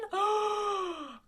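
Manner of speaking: big high theatrical gasp; mock-horrified gasp. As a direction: gasp in exaggerated shock at your own slip. Mock horror at own blunder.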